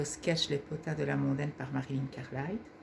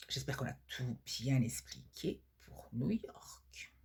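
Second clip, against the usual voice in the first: slow conspiratorial whisper; 4 semitones lower, 5 dB quieter, slower than usual. Acted. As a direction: hush it to the agent. Conspiratorial aside to agent.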